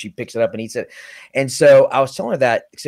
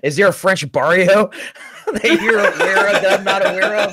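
higher, louder mocking father; father's mock question; 8 semitones higher, 3 dB louder than usual. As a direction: quote dad's sarcastic question with a laugh. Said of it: "my father said".

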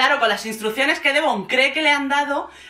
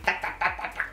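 chopping noises; 4 semitones lower, 9 dB quieter than usual. Retold or imitated. Imitating knife chopping sounds.

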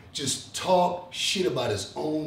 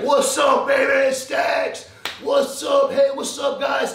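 excited greeting; 8 semitones higher, 4 dB louder than usual. Retold or imitated. Fans greeting him at booth.

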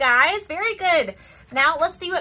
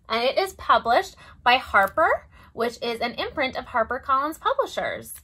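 usual speaking voice

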